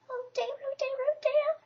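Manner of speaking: high, squeaky fretting little voice; worried Piglet. A narrator's reading. Piglet worrying to himself at the trap, high and anxious. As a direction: fret to yourself in a tiny high voice. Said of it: "said Piglet to himself".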